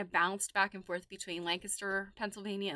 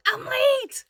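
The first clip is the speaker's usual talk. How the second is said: high, loud mock-panic cry; panicked realization; 12 dB louder than usual. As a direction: yelp it like you just saw the clock. All of a sudden you look back.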